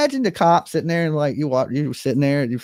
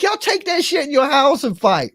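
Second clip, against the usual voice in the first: high scolding; 9 semitones higher than usual. Retold or imitated. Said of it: "I was like".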